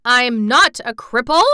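loud, high outraged denial; wounded pride erupting; furious cry. A narrator's reading. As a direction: shout it in fury. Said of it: "he cried out furiously".

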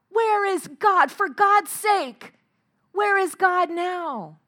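high, loud, slow cry of despair; anguished cry. Retold or imitated. Prisoner at the gallows crying out for God, anguished and loud. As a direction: wail it out, begging heaven.

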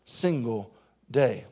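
low, slow and soft; drawn-out, hushed emphasis; slow emphatic drop. Real, not acted. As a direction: let each word land slowly. Preacher slows for emphasis.

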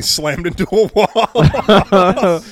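laughing through the words. Real, not acted. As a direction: giggle out the punchline.

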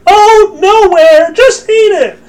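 high, loud falsetto brush-off; hammed-up woman's squeal, pushing him to comply; mock evasive Eve. Eve dodging Adam's question, mock falsetto.

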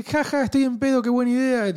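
put-on high, loud drunk party-girl voice; mock drunk girl. Retelling the girl's drunk decision, high hammed-up party voice. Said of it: "se pone y dice".

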